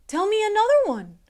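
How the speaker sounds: high, loud, fast eager begging; little brother begging for a story; begging boy. Acted. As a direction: plead like an excited little boy. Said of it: "begged William".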